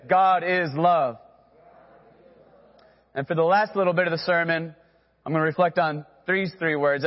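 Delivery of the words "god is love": slow, every word weighed; deliberate call-and-response line; slow call to repeat. Shown as a demonstration. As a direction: say it slowly for the congregation to echo. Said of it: "Everybody say".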